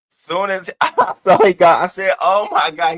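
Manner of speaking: high, loud laughing "Oh my God"; laughing surprise. Retold or imitated. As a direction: squeal with delighted shock.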